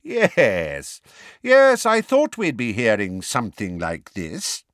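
drawn-out, mocking agreement; smug, smirking. A narrator's reading. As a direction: invite the room to share the joke. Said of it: "smirking unpleasantly".

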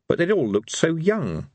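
plain reading voice

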